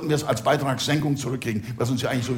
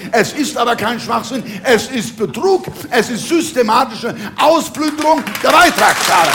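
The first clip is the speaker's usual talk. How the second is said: raised, emphatic outrage building to a peak; indignant rant; 7 semitones higher, 4 dB louder than usual. Acted. Cabaret speaker denouncing fraud to the audience, indignant and building. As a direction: hammer out each accusation, higher and louder.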